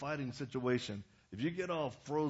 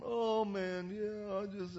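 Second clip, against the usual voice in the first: high, whimpering wimp voice; whiny wimp; 7 semitones higher, much slower than usual. Retold or imitated. A wimp making excuses to his counselor, whiny. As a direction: imitate a sniveling wimp, pitched up high. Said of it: "he's just a wimp".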